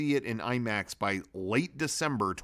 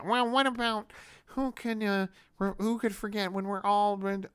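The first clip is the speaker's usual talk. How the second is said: mocking sentimental voice; 10 semitones higher than usual. Mocking sentimental covid films.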